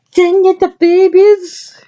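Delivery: high, slow, excited reading. Real, not acted. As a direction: sing it out, thrilled about the page. Reading scrapbook page excitedly.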